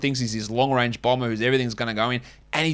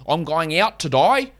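slow, mock Aussie accent; bad Australian accent; 2 semitones higher than usual. Shown as a demonstration. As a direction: exaggerate a badly faked Australian accent. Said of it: "like, a bad Australian accent saying".

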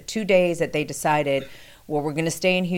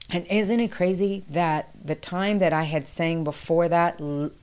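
ordinary delivery

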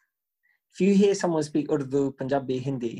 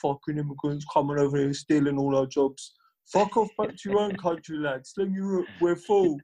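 mock anti-immigrant heckler, lightly put on; ranting British xenophobe. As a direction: imitate a bigot telling foreigners off.

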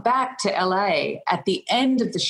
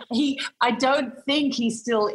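ordinary talk

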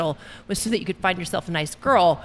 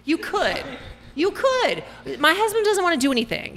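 excited; 6 semitones higher than usual. Real, not acted. Excited protest about husband.

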